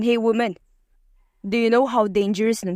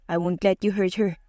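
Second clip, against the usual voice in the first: forced Damian imitation. A narrator's reading. Vincent mimics Damian to tease Aubrey, mock stern. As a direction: copy the boss's hard line in jest. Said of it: "pilit na ginaya ni Vincent ang boses ni Damian".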